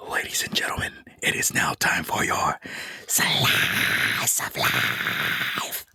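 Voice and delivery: slow stage-whispered announcer intro; whispered announcement. Acted. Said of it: "if you could, please".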